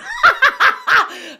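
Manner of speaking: high, loud burst of laughter; real cackling at own acting. Real, not acted. Storyteller cracks up at her own impression, cackling.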